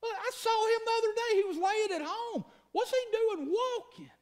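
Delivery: high-pitched, amazed townsfolk voice; astonished onlookers. Acted. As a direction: play a gossiping bystander who can't believe it. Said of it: "people sitting in shop windows going".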